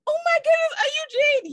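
high, loud shriek of recognition; mock fan shout. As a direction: scream it like a fan at the airport. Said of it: "You just".

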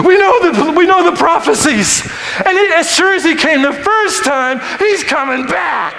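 high, loud shouted sermon; full-force preaching. Real, not acted. Preacher telling the congregation about God's plan and his own, shouted preaching. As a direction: preach it at the top of your voice.

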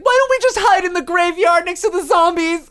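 high-pitched mock suggestion; clueless character. Voicing a clueless horror character.

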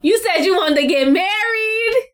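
drawn-out high exclamation; slow, high-pitched mock shock; astonished outburst. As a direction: stretch it out in playful disbelief. Friend reacts to marriage talk.

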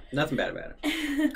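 high, laughing mock reassurance; sarcastic disclaimer. Real, not acted. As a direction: say it with ironic innocence.

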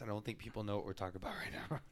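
hushed whispered aside. Real, not acted. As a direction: whisper it to the room. Quiet aside about off-mic talk.

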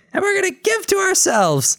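very high squeaky blurt; Pinky voice. Retold or imitated. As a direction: blurt it out like a goofy cartoon mouse.

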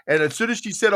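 ordinary delivery

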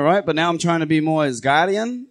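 ordinary talk

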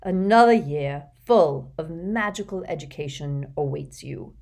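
stately public welcome with swelling opening phrases; headmaster's address. Acted. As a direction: address a whole hall, measured and ceremonious. Voices headmaster addressing the hall.

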